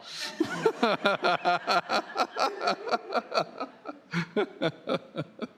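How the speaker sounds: high, loud rolling laughter; long laugh. Real, not acted. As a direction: laugh hard at your own joke. Long laugh ending the skit.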